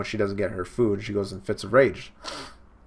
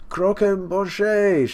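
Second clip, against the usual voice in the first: high, louder, very slow Croquembouche; drawn-out demand; 8 semitones higher, 4 dB louder, much slower than usual. Retold or imitated. Big Mom wailing for her cake, a louder drawn-out cry. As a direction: stretch Croquembouche out into a greedy wail.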